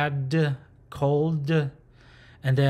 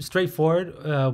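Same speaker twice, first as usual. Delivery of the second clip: usual speaking voice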